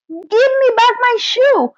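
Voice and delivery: high, indignant cry for the shoe; angry demand. A narrator's reading. Little girl to the witch, angry high demand. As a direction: demand the shoe back, upset. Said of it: "grew angry and said to the witch".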